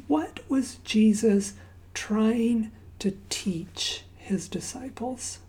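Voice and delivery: normal talking voice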